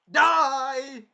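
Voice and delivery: one high, loud drawn-out shout; hammed-up crazed battle cry; villain's mock yell. Villain with insanest eyes attacking, mock crazed yell.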